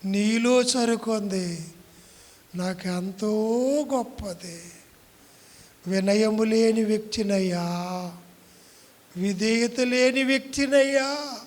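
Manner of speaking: loud, pleading prayer to God; fervent prayer. Real, not acted. Pastor leading the church in prayer, loud pleading prayer. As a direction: cry out the prayer to the Lord.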